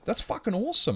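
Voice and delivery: high-pitched imitation of delighted viewers; excited players. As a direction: play the stunned happy player. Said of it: "And they go".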